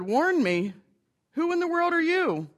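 incredulous burglar. Acted. Burglar startled at the talking parrot, incredulous. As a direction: make it climb in disbelief.

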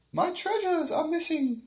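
very high, shrill queen; high fluttering royal panic; distressed queen. Acted. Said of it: "she cried".

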